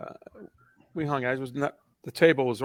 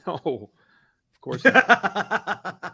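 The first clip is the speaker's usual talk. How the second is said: mock denial; 4 semitones higher, much slower than usual. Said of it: "I said".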